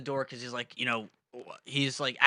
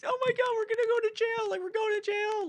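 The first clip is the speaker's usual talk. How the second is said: very high-pitched wailing imitation; mock crying panic. Imitating crying girls in the back seat, mock panicked wail. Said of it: "are literally crying".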